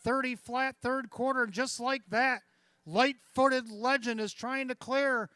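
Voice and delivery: loud, high stretch call; excited race caller. Real, not acted. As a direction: build to a roaring finish. Stretch drive building.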